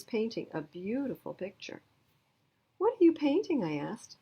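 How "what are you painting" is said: put-on high, eager girl's question; curious little girl. Girl asks new classmate, high and curious. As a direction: ask brightly, leaning in.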